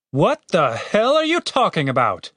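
high-pitched incredulous demand; exasperated. A narrator's reading. Captain to the cleric, exasperated disbelief.

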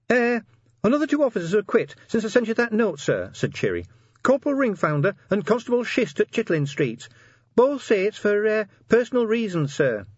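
very high, hesitant sergeant voice; reporting sergeant. A narrator's reading. Sergeant tells the commander about resignations, high and hesitant. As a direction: report bad news in a high little voice.

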